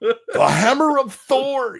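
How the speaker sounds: high, slow, unhinged screech; unhinged Dennis. Retold or imitated. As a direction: channel Dennis losing it. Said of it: "Dennis when he loses his shit".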